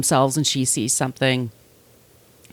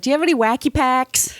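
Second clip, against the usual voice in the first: put-on high, childlike question; eager kid; 8 semitones higher than usual. Kids asking candy store for cards, eager kid voice. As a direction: ask it high like a hopeful kid.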